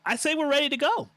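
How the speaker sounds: high, loud, quick announcement; rushed, high-pitched character shout; Pretzels. Acted. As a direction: call it out as an eager game character. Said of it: "Pretzels comes up and says".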